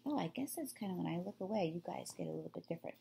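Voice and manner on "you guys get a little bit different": ordinary delivery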